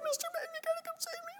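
put-on high, fast, soft child's plea; a little kid stuck on the bars, whining for rescue; whimpering kid. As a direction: beg softly like a frightened child. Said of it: "like this".

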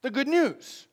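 the good news lifted high in a different voice; a hint of a pulpit announcement; announced term. Shown as a demonstration. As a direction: present the good news like a title. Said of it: "that's what we'd call it".

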